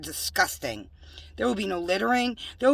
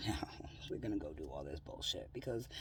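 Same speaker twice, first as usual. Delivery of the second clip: whisper; 17 dB quieter than usual. Real, not acted. Whispered laughing aside.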